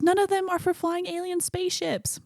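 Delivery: high-pitched retold objection; hammed-up exasperation, pitched high throughout; mock exasperated protest.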